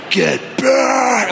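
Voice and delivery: full-throated high shout to drive off a dog; Gandalf-style yell. Retold or imitated. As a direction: roar it like a wizard holding the line. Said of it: "I yell at the top of my lungs like Gandalf".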